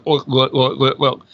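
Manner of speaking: back-of-the-throat Scottish glugging; throaty Scottish sounds. Shown as a demonstration. Actor showing where Scottish sits, throaty accent. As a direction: make swallowed Scottish syllables as if chugging Guinness. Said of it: "it's back here".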